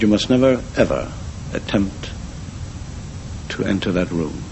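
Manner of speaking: grave warning sinking very soft; restrained anger, fear. Acted. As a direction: forbid it quietly, letting the voice fall away. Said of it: "angry and frightened".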